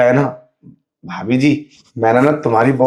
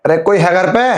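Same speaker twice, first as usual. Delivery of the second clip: higher, louder call into the house; 6 semitones higher, 4 dB louder than usual. Acted. Fake blind man calls into the house, loud and high. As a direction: call out as if you can't see the way.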